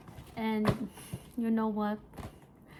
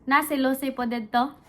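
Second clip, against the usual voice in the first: louder, quicker explanation to someone off camera; louder, hurried aside; calling to someone; 8 dB louder than usual. Real, not acted. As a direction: raise your voice to someone across the room.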